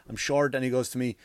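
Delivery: normal talking voice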